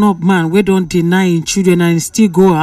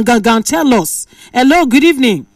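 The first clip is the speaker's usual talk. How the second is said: ordinary talk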